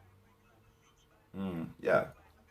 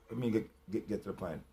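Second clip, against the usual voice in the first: drops to a near whisper, much softer and lower; hushed repeat; 8 semitones lower, 10 dB quieter than usual. Acted. Quietly repeating his own demand to himself, hushed. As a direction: mutter it under the breath.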